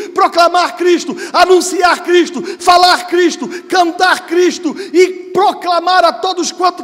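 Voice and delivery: high, very loud, full-force pulpit cry; impassioned preaching. Real, not acted. Preacher urges the church to proclaim Christ, impassioned shouting.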